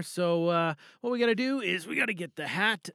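ordinary talk

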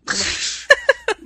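high wild cackle; wild rambling cackles. Pretend. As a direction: cackle like a crazed old farmer. Mock hillbilly cackle.

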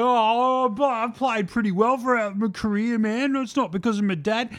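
aggrieved, whiny protest, hammed up; whingeing. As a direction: imitate a player having a big whinge. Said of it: "has a big fucking whinge".

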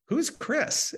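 high, puzzled "Who's Chris?" with a rising end; confused who's. Retold or imitated. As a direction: ask "Who's Chris?" sharply up in pitch, truly puzzled. Retelling his own confused reply.

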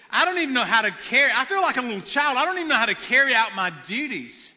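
a rushed, desperate confession of helplessness; urgent pleading as Solomon. Acted. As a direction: plead like an overwhelmed young king. Solomon's anxious plea, re-acted.

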